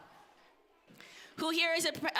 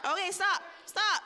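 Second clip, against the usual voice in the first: higher, louder call to stop; 7 semitones higher, 4 dB louder than usual. Real, not acted. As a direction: raise voice to halt a noisy room. Calls kids to stop counting.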